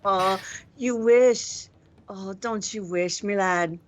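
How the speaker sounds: slow, drawn-out mocking sneer; mocking taunt. Reaper mocks the pinned changeling, slow and sneering.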